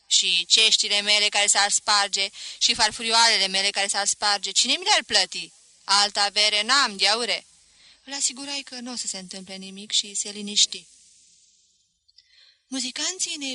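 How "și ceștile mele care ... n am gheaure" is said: loud, anxious complaint; fretful outcry. Acted. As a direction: fret loudly over the breakable cups. Voap fears for his cups.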